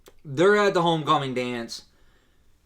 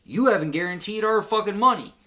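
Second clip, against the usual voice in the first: higher, louder acted protest; imitating an indignant crowd; mock angry complaint; 7 semitones higher, 5 dB louder than usual. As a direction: complain like an aggrieved customer. Crowd confronting George over money.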